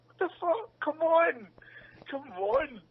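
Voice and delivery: high-pitched exasperated disbelief; exasperated protest. Retold or imitated. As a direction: replay an indignant, pleading protest. Acting out reaction to no reward.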